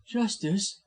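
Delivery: scornful retort. A narrator's reading. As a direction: throw the word back in scorn. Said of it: "echoed Janet with a scorn".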